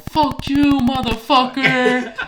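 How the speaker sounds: high, drawn-out loud taunt; stretched-out mock battle cry; taunting yell. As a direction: imitate a friend yelling from a fence top. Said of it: "stops on top of the fence and yells".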